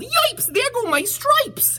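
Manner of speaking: very high, squealing zebra yell. Acted. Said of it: "The zebra yelled".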